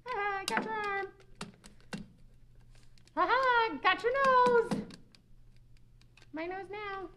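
high, taunting puppet voice; a high, mocking playground bully toy; teasing toy voice. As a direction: tease like a toy snatching a nose. Voicing a taunting potato toy.

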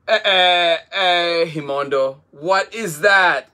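loud, high, stammering with wonder; can hardly get the question out; eager little boy spotting something new; excited. Acted. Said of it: "asked Abelo with excitement".

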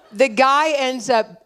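words slowed, broken by laughter; laughing. Real, not acted. Pastor to the congregation, laughing while continuing the story. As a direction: still laughing at the joke.

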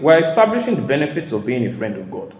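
normal talking voice

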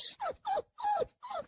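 high-pitched mock whining; imitated dog whimper. Owner imitating his dog seeing a squirrel, high whimpering. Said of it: "it, it cries".

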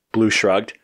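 plain reading voice

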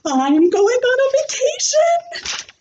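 put-on high, squealing kid voice; excited shout. Junie B announcing her vacation to class, high excited squeal. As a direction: shout it out, bursting with joy. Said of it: "I shouted".

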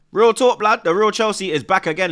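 loud, hyped-up call to the chat; excited shout. Real, not acted. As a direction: shout it out like a fan on a livestream. Hyping the chat on stream.

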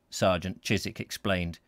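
usual speaking voice

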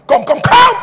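loud, high call to come forward; shouted eager summons; excited calling. Real, not acted. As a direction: call them up with joy. Pastor calls people forward excitedly.

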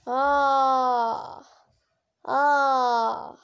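long drawn-out pre-sneeze "aah"; sneeze build-up. Acted. Dragon about to sneeze as families watch, long "aah" build-up. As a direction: hold each "ah" as the sneeze builds. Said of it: "The noise erupted from the dragon".

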